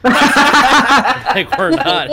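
loud, high laughing exclamation; laughing protest. Real, not acted. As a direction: shout it out high through laughter. Joking protest amid group laughter.

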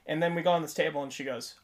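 ordinary talk, nothing marked

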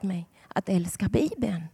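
whispered, tender and unvoiced; soft whisper. Real, not acted. Preacher whispering to the congregation how she learned to love the Bible. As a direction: breathe it like a secret.

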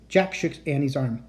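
ordinary delivery

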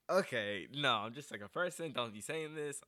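imitating herself waving off a compliment, keyed up; flustered protest. To the young DJ praising her on the phone, flustered excited protest.

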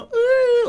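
very high squeal of pleasure; mock delight. Mocking people who squeal when they finally eat. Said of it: "y cuando como".

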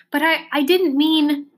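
high, stammering protest; insist, stumbling over the start; insistent. A narrator's reading. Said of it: "insisted Milo".

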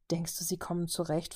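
soft night-time whisper; whispering in bed to her roommate; whispered question. Acted. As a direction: whisper so the house stays asleep. Lights out, asking in bed.

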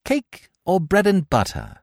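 high, clipped hostess question; severely. Acted. Cecily to Gwendolen, severe offer of cake. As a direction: serve it with a sharp edge. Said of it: "said Cecily severely".

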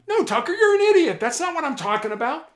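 high, fast, loud mock yell at the negotiator. Angry caller yelling at the negotiator, a loud, high, fast retort. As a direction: snap it out as an angry high-pitched outburst. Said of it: "they'll yell at you".

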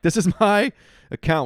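high, loud mock protest with a laugh; mock indignation. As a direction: play the put-out account owner, half laughing. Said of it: "And I'm like".

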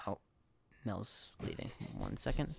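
quiet, breathy aside off-mic; muttered aside while someone leaves; hushed aside. Real, not acted. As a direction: drop to a hush for a quick aside.

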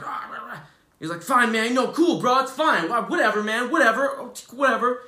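high, loud, huffy mock outburst of a sulking friend; retold flip-out. Friend storming off after being told to leave, mock huffy outburst. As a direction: play the offended friend, pitch jumped way up and loud. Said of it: "he just flipped out".

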